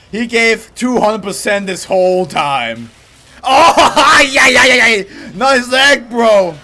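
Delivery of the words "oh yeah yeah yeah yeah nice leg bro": loud, higher laughing cheers; laughing hype. Real, not acted. Laughing, hyped at the fight.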